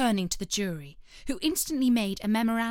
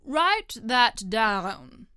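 king voice; 5 dB louder, much slower than usual. Acted. King to jury.

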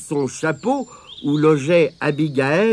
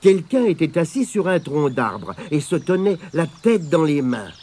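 ordinary delivery, nothing marked

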